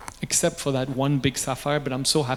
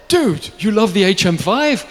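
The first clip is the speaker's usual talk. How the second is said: loud, higher-pitched astonished question; amazed disbelief; 6 semitones higher, 6 dB louder than usual. Retold or imitated. Watchmaker answering an unexpected fan of his flop, amazed disbelief. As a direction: blurt it out in happy disbelief.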